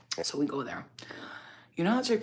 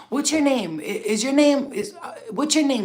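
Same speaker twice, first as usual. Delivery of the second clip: high, raised, stumbling retold question; excited questioning; 8 semitones higher, 6 dB louder than usual. Manager chasing a driver he half recognizes, excited questioning. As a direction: imitate someone urgently asking, voice pitched up.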